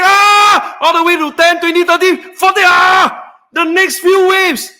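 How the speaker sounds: high, loud shouted countdown; rising shout. Real, not acted. Host warning viewers of endless waves, shouting. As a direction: yell the numbers as they climb.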